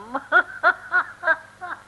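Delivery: high, loud villain's cackle over his captives; gloating villain laugh. Acted. Hatsike gloats to his prisoners with a loud high cackle. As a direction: laugh with high, cruel glee.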